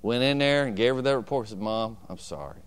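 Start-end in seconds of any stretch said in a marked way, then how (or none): 1.6-2.6 sheepish apology. Retold or imitated. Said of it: "I didn't say it like that".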